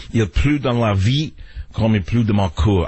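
put-on French-accented poetry recital. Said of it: "in the French accent".

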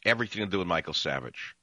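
ordinary talk, nothing marked

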